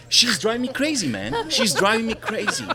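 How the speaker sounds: high-pitched, put-on exasperated venting; mock exasperation. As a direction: complain about a coworker in hammed-up frustration. Feigned venting to a telemarketer about coworker.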